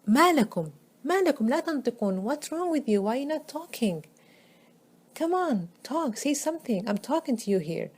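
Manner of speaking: high, pressing scornful challenge; angry taunt. Retold or imitated. Ibrahim angrily challenging silent idols to talk, high-pitched. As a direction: demand they speak, exasperated. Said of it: "how he's talking out of his anger".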